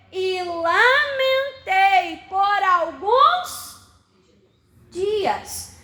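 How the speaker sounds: slow it right down and lean on each phrase of the verse; slow scripture reading. Acted. Preacher reading the verse of Nehemiah's grief to the church, slow and solemn. Reads verse four slowly.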